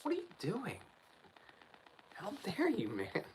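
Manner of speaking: high, slow mock telling-off; indignant scolding. Retelling scolding the rude neighbour, indignant and slow. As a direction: scold him slowly, as if he should know better. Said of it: "I'm like".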